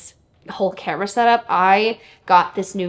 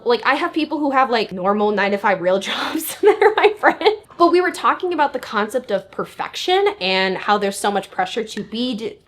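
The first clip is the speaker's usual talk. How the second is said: ordinary talk, nothing marked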